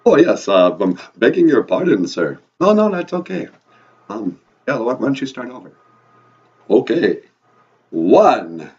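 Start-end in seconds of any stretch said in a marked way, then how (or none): 0.1-2.4 put-on apologetic vampire accent; loud Transylvanian accent, falling low on the last word; Count. Count apologizing to the host for laughing, vampire accent. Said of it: "your vampire accent". 6.7-8.7 loud vampire count, falling low; big Transylvanian accent with a long fall. Acted. Count starting the count over, booming vampire accent. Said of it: "your vampire accent".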